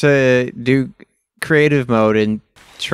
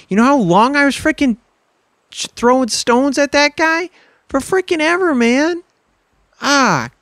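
high-pitched, aggrieved griping; exasperated complaint; 12 semitones higher than usual. Real, not acted. Gamer who threw stones forever for nothing, exasperated complaint. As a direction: protest the wasted effort, pitched way up. Complaining about wasted effort after loss.

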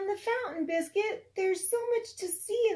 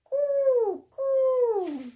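pigeon coo; 7 semitones higher, 5 dB louder than usual. Acted. Teacher cooing as pigeons for a child, lightly.

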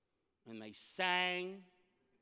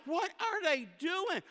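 much higher, slow bewildered question; baffled; 11 semitones higher than usual. Retold or imitated. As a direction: stretch it out in disbelief. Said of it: "and I'm like".

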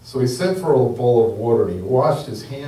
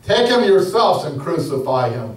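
a louder, raised shout at the priests; Pilate shouting; 5 semitones higher, 5 dB louder than usual. A narrator's reading. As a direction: throw it back at them in exasperation. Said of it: "Pilate shouted".

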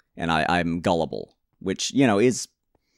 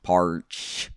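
put-on slow, stretched backwoods country accent; put-on hillbilly drawl; much slower than usual. Player setting off to find villages, mock country drawl.

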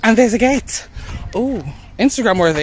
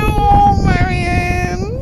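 tearful; 11 semitones higher, 4 dB louder, much slower than usual. Real, not acted. Emotional reunion with friend Marion.

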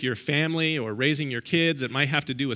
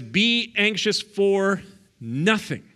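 "Be anxious for nothing" very slow, higher and louder, each word weighted; stated as command; 6 semitones higher, 4 dB louder, much slower than usual. Shown as a demonstration. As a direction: lay it down like an order from the pulpit. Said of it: "But it says".

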